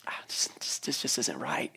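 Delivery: breathy whisper of inner unrest; hushed voice of a restless soul; whispered lament. Retold or imitated. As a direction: whisper it like a private complaint to yourself. Imagined weary inner voice.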